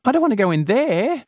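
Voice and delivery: put-on high, whiny girlish protest. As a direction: push back like a nervous kid. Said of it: "protests Celia".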